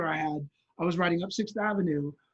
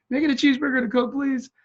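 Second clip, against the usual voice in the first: indignant; 7 semitones higher, 5 dB louder, faster than usual. Retold or imitated. Insisting at ignored counter.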